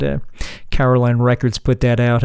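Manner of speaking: ordinary talk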